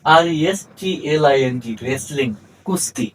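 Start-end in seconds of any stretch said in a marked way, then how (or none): 1.9-2.3 রেসলিং starting straight on R, no W; silent W. Shown as a demonstration. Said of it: "ডব্লিউ উচ্চারিত হয়নি".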